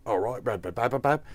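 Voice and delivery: quick stab at a British accent; babbled British caricature; mock British accent. Shown as a demonstration. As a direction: try on a silly British voice. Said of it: "It depends on which British you're going for".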